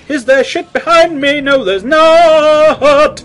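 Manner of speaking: high frantic check; panicky. Real, not acted. Checking behind him in the game, high and scared.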